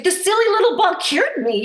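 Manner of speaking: high, loud delighted cry; imitating mom in happy disbelief; an elated put-on mom voice; mom's amazed exclaim. Mom marveling at the ball.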